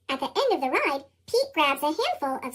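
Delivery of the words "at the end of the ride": usual speaking voice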